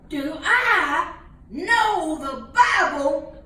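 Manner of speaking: put-on boastful young preacher. Young preacher answers the committee.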